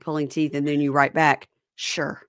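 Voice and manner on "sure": a mock reluctant, lifeless "sure"; unenthusiastic reply. Imitating the co-host's grudging text reply, unenthusiastic. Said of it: "I need enthusiasm".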